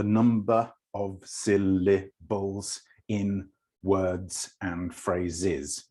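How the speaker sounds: slow, separated syllables. Shown as a demonstration. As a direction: break the words into measured beats, slowly. Demonstrates syllables one by one.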